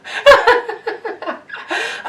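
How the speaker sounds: giggling burst; high laugh. Real, not acted. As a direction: break into giggles. Laugh at guest.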